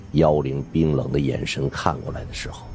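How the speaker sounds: plain reading voice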